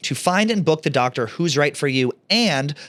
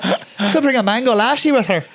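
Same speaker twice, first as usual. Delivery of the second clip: high, loud mock Irish lilt on the repeat; hammed-up Irish accent echo; 9 semitones higher, 5 dB louder than usual. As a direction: parrot his Irish accent back, laughing. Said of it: "why did you just speak in an Irish accent".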